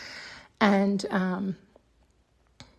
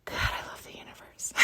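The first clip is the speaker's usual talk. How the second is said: whisper; 10 dB quieter than usual. Real, not acted. Whispered closing sigh.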